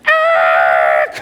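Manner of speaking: loud burst of laughter. Real, not acted. Pastor laughing at his own story, loud laugh.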